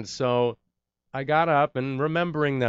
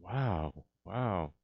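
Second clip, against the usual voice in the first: softer, breathless wow, wow; overwhelmed after the agent's call, quiet wow; hushed stunned wow; 8 dB quieter than usual. Retold or imitated. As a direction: let it fall out of you quietly in awe. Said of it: "And I went".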